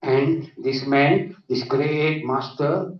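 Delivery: usual speaking voice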